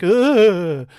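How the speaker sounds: a punched woman's groan; grunt of impact. Retold or imitated. Ghost woman getting punched in the movie, loud voiced grunt. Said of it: "she's just like".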